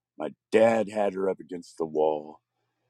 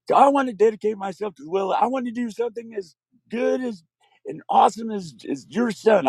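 high, eager vow to God; earnest childlike prayer; 10 semitones higher than usual. Retold or imitated. Boy praying to love, high and earnest. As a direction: pour out a child's promise.

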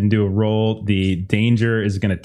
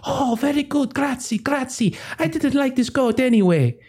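put-on high, gushing Italian accent; high Italian noblewoman voice; Italian-ish Maria; 14 semitones higher than usual. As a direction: thank him sweetly in a high Italian accent. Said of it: "Maria is like".

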